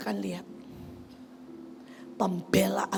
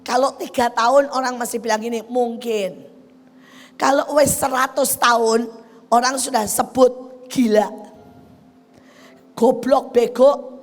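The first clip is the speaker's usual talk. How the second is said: fiery preaching; 3 semitones higher, 9 dB louder than usual. Acted. Pastor preaching to the congregation about scorn, fiery. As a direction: preach it hard to the crowd.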